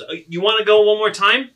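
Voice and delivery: high, loud, worked-up goading; cocky challenge. Acted. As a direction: egg them on for a rematch, loudly. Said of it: "he goes".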